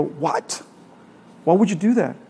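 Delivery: ordinary talk, nothing marked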